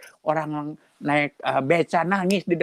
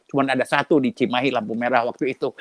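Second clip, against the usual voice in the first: usual speaking voice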